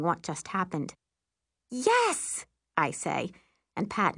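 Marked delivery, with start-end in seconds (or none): 1.7-2.5 high, exultant "yes"; triumphant cheer. A narrator's reading. Girl congratulating her sister, triumphant. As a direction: celebrate with a high-pitched cheer.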